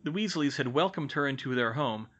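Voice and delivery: ordinary delivery, nothing marked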